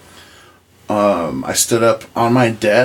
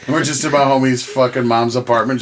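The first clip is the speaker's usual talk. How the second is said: normal talking voice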